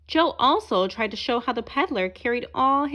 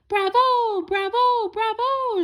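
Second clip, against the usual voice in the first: shouting bravo; 9 semitones higher, slower than usual. A narrator's reading. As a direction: cheer together with delight. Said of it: "shouted the rest".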